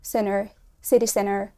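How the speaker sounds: "Center" as "senner"; no T after the N in "center"; dropped T. Shown as a demonstration. Teacher modelling "City center" without the T. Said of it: "مش هتتقال center".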